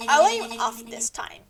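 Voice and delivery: ordinary talk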